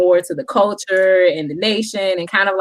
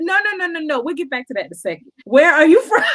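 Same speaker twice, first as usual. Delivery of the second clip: laughing insistence; 8 semitones higher, faster than usual. Retold or imitated. Brushing aside the argument to ask where he's from, laughing. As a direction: wave it off and press on laughing.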